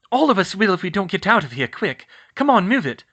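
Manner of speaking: high, loud hurried order; rally them to get moving; urgent command. Acted.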